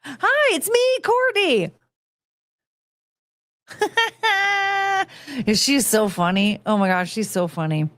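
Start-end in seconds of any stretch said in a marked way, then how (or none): 0.1-1.7 very high, chirpy hello; high sing-song greeting. Shown as a demonstration. Greeting coworkers the Pam way, very high sing-song. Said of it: "I should start doing that again". 3.7-5.0 laugh. Real, not acted. Laughing at own impression.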